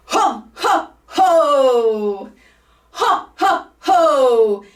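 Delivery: loud, high jolly belly laugh ho ho ho; Santa Claus laugh. Shown as a demonstration. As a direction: push each ho from the diaphragm. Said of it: "So it's gonna sound like this".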